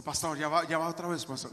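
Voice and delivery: low, quiet aside in another's voice; grumbling congregant. Acted. Congregant objecting to the pastor, low muted grumble. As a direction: play a congregant's subdued objection.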